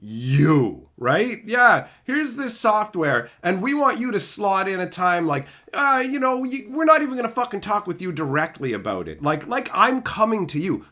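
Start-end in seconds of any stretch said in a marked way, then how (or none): 1.5-10.8 high, mock-sweet marketer voice. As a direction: parody a middleman circling back. Mocking marketer emails.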